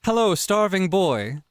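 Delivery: slow formal greeting. Acted. Greeting in posh role voice.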